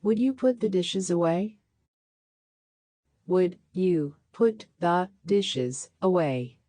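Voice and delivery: ordinary talk, nothing marked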